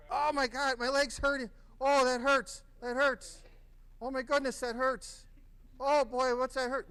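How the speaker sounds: high, loud pained cries; acted pain. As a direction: keep wailing like a bleeding patient. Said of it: "I'm done screaming".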